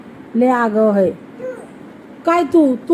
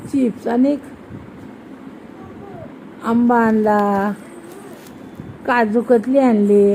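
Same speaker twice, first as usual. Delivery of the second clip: slow, lower, drawn-out listing; slow prompting; 3 semitones lower, 4 dB quieter, slower than usual. Shown as a demonstration. Mother reminding her child what grandfather brought, slowly prompting. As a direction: stretch each item out to prompt a reply.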